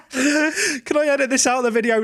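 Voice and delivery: laughing through an embarrassed question. Real, not acted. Laughing at his own slip.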